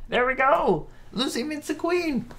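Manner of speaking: very high, squeaky sing-out announcement; playful high voice. Pretend. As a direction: pipe it up high in a silly voice. Announcing the episode title playfully.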